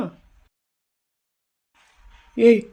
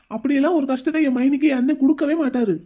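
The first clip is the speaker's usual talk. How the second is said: ordinary talk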